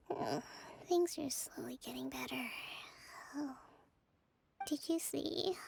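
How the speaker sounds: 꿈결에 속삭이듯 잠꼬대; sleep-talking whisper. Acted. Kokomi talking in her sleep.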